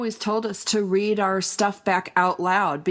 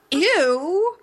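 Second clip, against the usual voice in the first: high, drawn-out "ew"; retold cringe, pitched up; disgusted; 8 semitones higher, 3 dB louder than usual. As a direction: recoil in mock disgust. Retold disgust at a horrible line.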